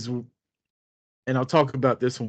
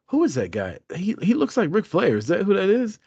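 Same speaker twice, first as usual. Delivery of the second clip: higher-pitched imitation of a puzzled bartender; lightly voiced curious bartender; 6 semitones higher than usual. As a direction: lightly mimic a bartender asking a coworker. Bartender quizzing coworker about famous customer.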